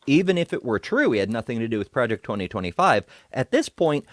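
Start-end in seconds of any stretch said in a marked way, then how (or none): none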